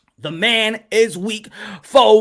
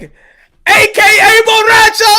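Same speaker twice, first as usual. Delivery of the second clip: high, loud, slow shout of Borracho; shouted nickname; 10 semitones higher, 8 dB louder, slower than usual. Retold or imitated. Friends hyping the nickname, shouting it high and slow. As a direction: bellow the nickname out. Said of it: "both of them could be like".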